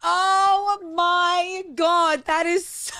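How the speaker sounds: high, loud, drawn-out amazement; delighted outburst. Real, not acted. Cohost reacting to dad's outrageous story, delighted outburst. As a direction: stretch it out in delighted disbelief.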